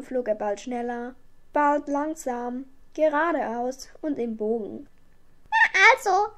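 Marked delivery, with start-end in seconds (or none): 5.5-6.3 high, loud, satisfied little witch; zufriedene kleine Hexe. A narrator's reading. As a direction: say it high and pleased. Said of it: "sagte die kleine Hexe zufrieden". (hohe, zufriedene Hexenstimme)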